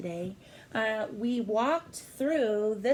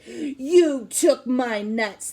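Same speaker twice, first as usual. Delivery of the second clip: slow, louder accusing squirrel; protesting squirrel; 4 semitones higher, 10 dB louder, much slower than usual. Acted. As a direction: stretch out the accusation. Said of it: "the squirrel protested".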